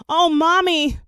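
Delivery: high, loud alarmed cry; exclaiming. A narrator's reading. Lisa calling to her mother, loud and high. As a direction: cry out in dismay for the lost bear. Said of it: "she exclaimed".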